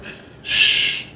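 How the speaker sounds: whispered shh to quiet him; hushing whisper. Retold or imitated. Weezy quieting Dylan, whispered shh.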